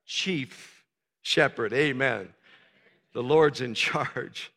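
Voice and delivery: hushed, slow solemn emphasis; low soft emphasis. Acted. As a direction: let it sink in, hushed.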